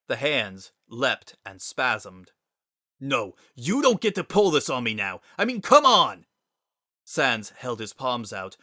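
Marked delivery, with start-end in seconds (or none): 3.0-6.3 loud angry outburst, rising to a high cry; angry shout. Acted. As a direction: yell it, cut him off hard. Sans yells at Gaster.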